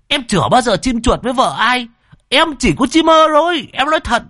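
panicked high-pitched denial; flustered high squeak. Acted. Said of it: "đỏ mặt run bần bật".

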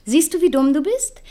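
laut und höher, verächtlich; scharfe Abfuhr. Acted. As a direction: fahr ihn laut und spöttisch an. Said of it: "Deine Duschen sind nicht immer sehr charmant". (Solange fährt Pierre im Streit laut und höhnisch an)

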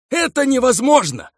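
high shocked outcry; outraged cry. Acted. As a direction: cry it out in disbelief. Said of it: "вскрикнул Каруба".

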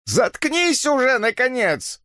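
high, drawn-out disgusted rebuke; contemptuous snapping. A narrator's reading. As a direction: shut him up with shrill contempt. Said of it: "буркнула мамаша Хемингуэй с презрением". (с презрением, высоким голосом)

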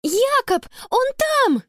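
high-pitched cry of relief; excited outcry. A narrator's reading. Sister hearing her lost brother is found, excited. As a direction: burst out with the news, voice way up.

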